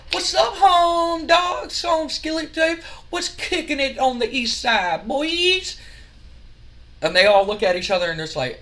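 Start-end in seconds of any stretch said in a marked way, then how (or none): 0.1-5.8 much higher, slow drawled slang; mock street slang. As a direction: strut into it, stretching every word. Grandmaster Sexy struts up.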